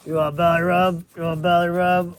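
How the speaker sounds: loud, sing-song pet talk. Real, not acted. Cooing loudly at the pig about a belly rub.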